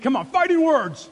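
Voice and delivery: rally them to really mean it; preacher firing up the crowd, loud; shout like you mean it. Shown as a demonstration. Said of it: "say it like you mean it".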